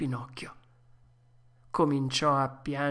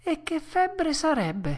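put-on high, slow puppet-boy question; a worried child, pitched high and drawn out; anxious Pinocchio; 13 semitones higher than usual. A narrator's reading. Pinocchio asking about his fever, high and slow.

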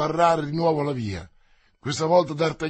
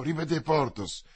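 plain reading voice

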